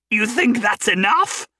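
high, loud manic scream; screaming wildly. Acted. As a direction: scream it wildly, spitting mania. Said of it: "Hendren screamed wildly".

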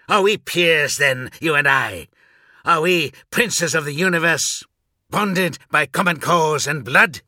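high, loud scornful questions; apoplectic outburst. A narrator's reading. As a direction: fling the questions out in sudden fury. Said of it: "sudden burst of apoplexy".

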